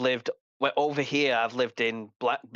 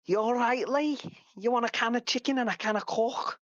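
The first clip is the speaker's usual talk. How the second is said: high, broad Scouse nan voice; mock Scouse granny offering a can of Coke; Liverpool nan accent; 9 semitones higher than usual. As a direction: do your nan's thick Liverpool accent. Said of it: "not got the full accent like my nan has".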